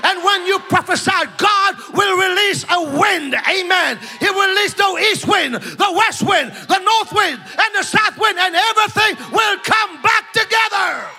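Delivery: high, driving preacher's shout; rousing revival-style exhortation; full-force preaching. Real, not acted. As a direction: preach it up to a long, high cry.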